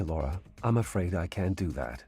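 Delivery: usual speaking voice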